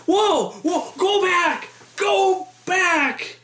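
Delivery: high-pitched alarmed calling out; mock yell. Audience yelling to rewind the movie, mock alarm. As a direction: holler it high without real volume.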